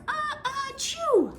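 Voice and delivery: high acted sneeze build-up; a child sneezing, uh uh achoo. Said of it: "sneezed Avery".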